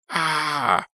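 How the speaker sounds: high, drawn-out cry of fright; startled scream. A narrator's reading. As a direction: scream as the attack hits. Wolf attacks, startled scream.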